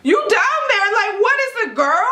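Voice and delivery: very high, loud retold panic; freaking out. Mother questioning her husband about a girl, freaking out. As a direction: act out frantic disbelief, voice shooting up. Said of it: "I'm, like, freaking out".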